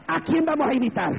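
high, ringing challenge to the congregation; insistent repeated question. Real, not acted. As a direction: lift the whole question high, pressing for an answer. (pregunta insistente, en tono agudo)

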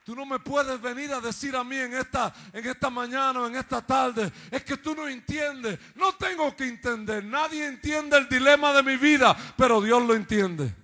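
loud, high preacher's cry, building; full-voiced pulpit fervour; fiery preaching. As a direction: preach it to the back row. Preaching at full force continues.

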